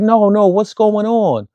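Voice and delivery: put-on high, slow insistent child voice; young girl. Kid not buying her host's answer, high insistent child voice. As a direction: press the question like a little kid. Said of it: "she's like".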